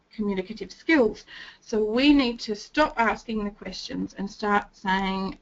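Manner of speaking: normal talking voice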